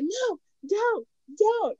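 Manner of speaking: put-on high little-girl pleading; imitating a two-year-old begging; mock toddler plea. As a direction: squeal it like a scared toddler. Toddler pleading in retold scene.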